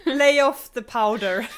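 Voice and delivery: loud laughing plea. Real, not acted. Laughing at Mark Hamill's makeup.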